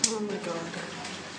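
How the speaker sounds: slow, hushed, barely voiced; terrified whisper. Real, not acted. As the tornado hits, whispering in terror. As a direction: let it slip out in dread.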